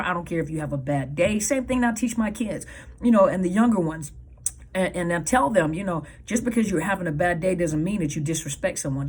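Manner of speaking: normal talking voice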